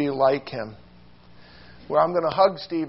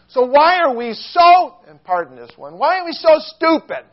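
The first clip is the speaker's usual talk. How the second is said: preaching rebuke; 8 semitones higher, 9 dB louder than usual. Real, not acted.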